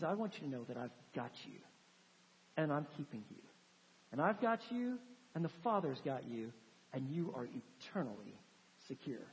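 low, soft and gentle, fading to a hush; tender reassurance. Acted. Pastor voicing Jesus to his congregation, softly reassuring. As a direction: reassure them like a shepherd holding his flock.

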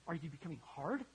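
ordinary delivery

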